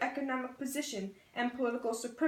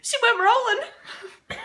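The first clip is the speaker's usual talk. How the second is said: slow, much higher and louder through laughter; laughing; 13 semitones higher, 12 dB louder, slower than usual. Real, not acted. Laughing at the rolling head, high and loud. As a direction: cackle out the words.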